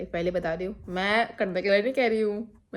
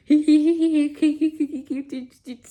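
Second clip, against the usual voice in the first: high, loud giggle fading to a breathy hush; giggling; 6 semitones higher, 6 dB louder than usual. Retold or imitated. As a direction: giggle helplessly, then let it die away. Giggling back at the seat.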